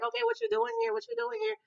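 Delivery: high-pitched, rapid-fire mimicked questions; nosy questioning. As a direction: imitate a friend prying in a high voice. Candace questions the evasive friend.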